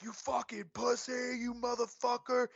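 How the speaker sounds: high-pitched imitation of a brawler cursing; high mock yell at no extra volume. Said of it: "yelling and arguing".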